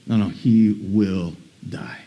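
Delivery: low, soft, slow menace; cold and final. Acted. Saul denying Jonathan's hope about David, a quiet cold menace. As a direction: deliver the death sentence coldly. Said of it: "here's Saul from his own lips".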